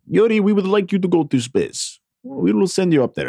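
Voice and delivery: high, hammed-up Russian accent; terrible fake Russian accent. Soviet boss summoning Yuri, fake Russian accent. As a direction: play a cartoon Russian boss with bad accent. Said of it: "That's a terrible fake Russian accent".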